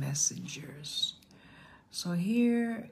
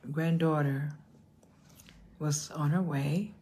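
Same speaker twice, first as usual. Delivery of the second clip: ordinary talk, nothing marked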